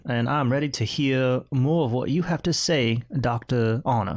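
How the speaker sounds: slow, put-on Southern gentleman; Southern drawl. Host handing over to co-host, tipsy Southern drawl. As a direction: stretch it into a genteel Southern drawl.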